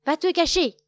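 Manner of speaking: loud, high shout. A narrator's reading. Loup ordering Loup Gris to hide, loud. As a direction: order him off loudly. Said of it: "crie Loup bien fort".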